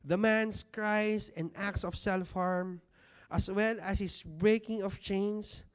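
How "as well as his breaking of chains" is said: normal talking voice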